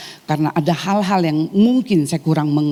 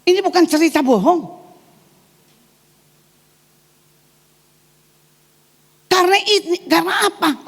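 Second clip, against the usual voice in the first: raised, fervent preaching pitch; preaching at full force; 11 semitones higher than usual. Acted. Preacher warning the congregation about the spirit of fear, fervent preaching. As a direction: drive the warning home like a pulpit sermon.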